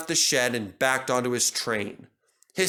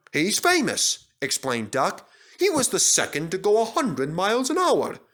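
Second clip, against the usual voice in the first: lively Duck; 7 semitones higher than usual. A narrator's reading. Duck voiced higher.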